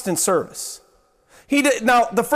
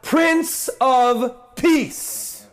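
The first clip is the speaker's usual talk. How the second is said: very slow, reverent Prince of Peace; drawn out slowly; 2 semitones higher, much slower than usual. Real, not acted. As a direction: stretch each word out with awe. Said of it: "Listen to this next one".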